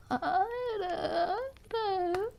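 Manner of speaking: high, wavering mock-dying wail; hammed-up grandma on her last breath. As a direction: draw it out, high and quavering. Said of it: "sound like I'm gonna die?".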